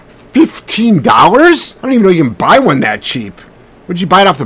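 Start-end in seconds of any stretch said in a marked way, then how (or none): 0.1-2.8 incredulous. Real, not acted. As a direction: stretch it out in real astonishment. Disbelief at a very cheap collar price.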